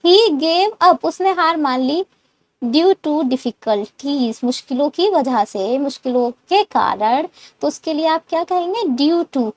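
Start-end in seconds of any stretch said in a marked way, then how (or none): none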